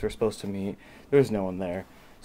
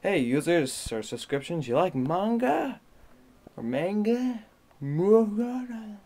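playful quoting voice; 7 semitones higher, slower than usual. Retold or imitated. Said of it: "where it said".

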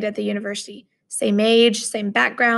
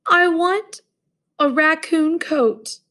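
wailed; 6 semitones higher, slower than usual. Acted. Roommate wailing for a raccoon coat, slow high wail. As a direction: wail it like a spoiled child. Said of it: "he wailed".

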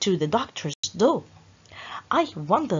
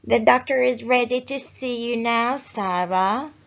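lightly voiced polite receptionist; a hint of a cheerful receptionist calling the patient; friendly receptionist calling Sarah in, lightly voiced; 2 semitones higher, 5 dB louder than usual. A narrator's reading. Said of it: "said the receptionist".

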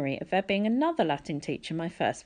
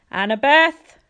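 higher, louder call across the lawn; a teacher summoning a student across the field; 8 semitones higher, 11 dB louder, slower than usual. A narrator's reading. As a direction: call her name from afar. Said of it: "Mister Bruner called to the blonde girl".

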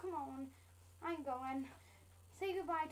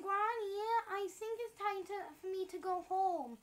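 ordinary delivery

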